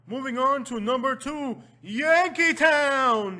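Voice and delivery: big, high announcer shout; countdown announcer. Pretend. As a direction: holler the next entry, stretching the name. Announces next entry in countdown.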